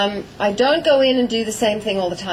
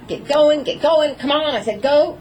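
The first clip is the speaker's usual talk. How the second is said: pushy urging; 5 semitones higher than usual. Retold or imitated. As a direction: nag the horse forward. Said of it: "you wanna use your phrases".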